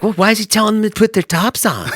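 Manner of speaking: mock indignant friend, pitched high; Hajar upset. Friend objecting about beachgoers' freedom, mock indignant. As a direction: imitate a high, protesting voice. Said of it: "I've never seen Hajar be upset".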